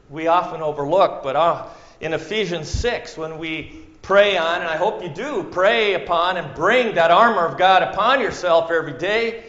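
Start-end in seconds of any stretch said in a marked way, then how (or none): none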